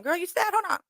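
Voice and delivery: high-pitched, playful self pep talk. Acted. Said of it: "I even lifted my chin".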